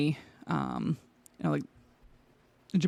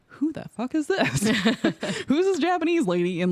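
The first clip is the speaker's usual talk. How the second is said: high, amused outburst of disbelief; baffled disbelief; 9 semitones higher than usual. Retold or imitated. Recalling seeing the portrait at the desk, amused bafflement. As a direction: retell a stunned reaction, pitched high and laughing. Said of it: "So I was like".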